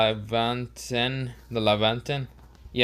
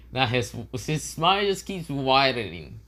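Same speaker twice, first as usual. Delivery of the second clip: ordinary delivery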